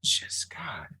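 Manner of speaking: breathy whispered aside. Real, not acted. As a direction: whisper it under your breath. Muttered aside during joke.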